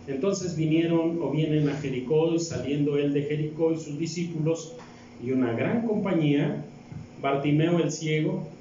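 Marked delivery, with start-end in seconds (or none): none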